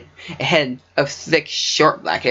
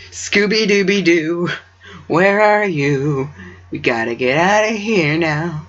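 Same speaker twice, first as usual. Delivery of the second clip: cartoon voice; 3 semitones higher, slower than usual. Jokey cartoon quote inserted.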